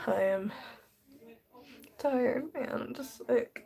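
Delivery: exhausted, tearful and losing the thread; real tearfulness. Real, not acted. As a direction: let the words trail off into tears. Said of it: "I'm literally covered in tears".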